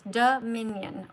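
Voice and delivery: Dominion slowed right down, do-mi-nion; slow syllables. Shown as a demonstration. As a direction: spell out Dominion slowly by its syllables. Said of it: "Three syllables".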